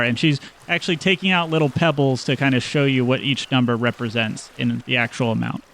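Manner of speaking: ordinary talk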